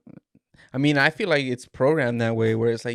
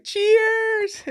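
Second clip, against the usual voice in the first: very high, squealed cheers; drunk toast; 6 dB louder than usual. Retold or imitated. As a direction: shriek the toast like a tipsy mom. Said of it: "She was like".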